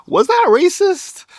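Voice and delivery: high, slow, puzzled wondering; doubtful question. Retold or imitated. Vlogger wondering aloud about a friendly remark, high and drawn out. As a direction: draw it out like you can't quite tell.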